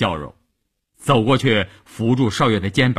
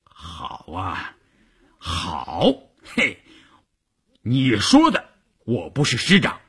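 through gritted teeth; 5 semitones lower, slower than usual. A narrator's reading. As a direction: hold the fury back behind clenched teeth. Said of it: "咬牙切齿的说". (咬牙切齿地压着火说)

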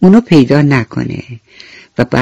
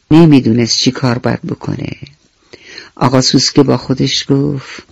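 plain reading voice

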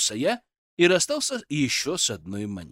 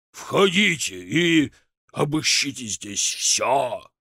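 slow, loud theatrical roar; 3 dB louder, much slower than usual. A narrator's reading. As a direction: bellow it for effect. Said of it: "взревел Костелано".

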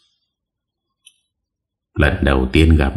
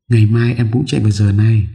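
put-on young woman voice; 6 semitones higher, faster than usual. A narrator's reading. Young woman character speaks.

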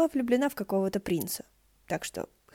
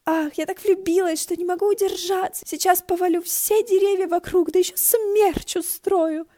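high louder mock maiden sighing over love; mock lovesick girl; 11 semitones higher, 8 dB louder than usual. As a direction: parody a sixteen-year-old in love. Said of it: "как смертная девица шестнадцати лет".

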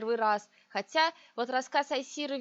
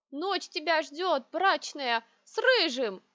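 high squeaky voice, slow then louder; squeaky disguised voice; 5 semitones higher, 5 dB louder, slower than usual. A narrator's reading. Dinar still disguised answers the bride, squeaky and rising. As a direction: keep up the squeaky lady act, building. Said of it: "ответил всё тем же писклявым голосом".

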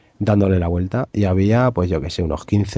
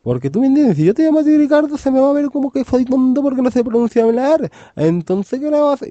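imitating Huelva man; 3 dB louder than usual.

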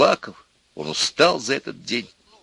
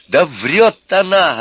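high, loud, drawn-out cry; loud indignant shouting; shouted accusation; 11 semitones higher, 8 dB louder, slower than usual. A narrator's reading. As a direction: yell it out in protest. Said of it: "опять закричал Костя".

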